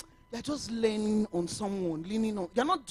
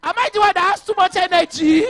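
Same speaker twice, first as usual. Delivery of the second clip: high, loud protesting wail, almost crying; laughing half-sob of protest; mock outraged plea; 7 semitones higher, 10 dB louder than usual. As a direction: beg in comic disbelief. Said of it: "And I said".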